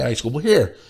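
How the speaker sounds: ordinary talk, nothing marked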